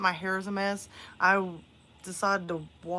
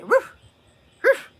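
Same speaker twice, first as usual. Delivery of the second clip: imitating barking; 13 semitones higher, 9 dB louder than usual.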